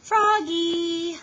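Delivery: high, loud call across the house. Acted. Mother calling her son from another room, loud and high. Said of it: "called his mother".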